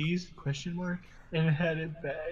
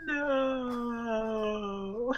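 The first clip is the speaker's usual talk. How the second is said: anguished cry; 8 semitones higher than usual. Acted. Dramatic cry in the story.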